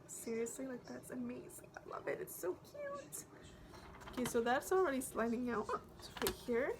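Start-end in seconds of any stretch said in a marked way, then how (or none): 0.1-2.2 hushed, admiring whisper. Real, not acted. Admiring the palette up close. 2.2-3.2 high, slow cutesy coo. Real, not acted. Cooing over cute packaging.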